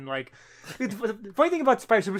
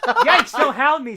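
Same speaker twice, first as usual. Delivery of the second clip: high, loud and slow mock cat squeal, laughing through it; squeaky cat voice; 8 semitones higher, 8 dB louder, slower than usual. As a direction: play the scared cartoon cat, drawn out. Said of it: "says the cat too".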